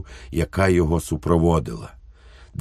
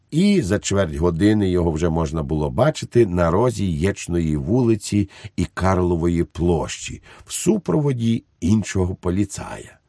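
plain reading voice

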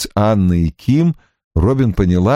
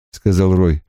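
ordinary delivery

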